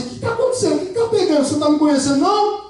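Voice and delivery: high, indignant offended voice; offended spouse. Retold or imitated. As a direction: play the hurt, baffled spouse. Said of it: "Você vai falar assim".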